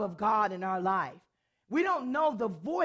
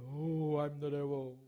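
mock devil voice; 7 semitones lower, 4 dB quieter than usual. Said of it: "it wasn't this".

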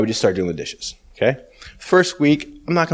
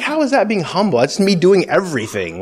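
high, outraged objection; indignant protest; 6 semitones higher than usual. Retold or imitated. Man protesting to the Holy Spirit, high and indignant. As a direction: protest the unfairness, pitched up high.

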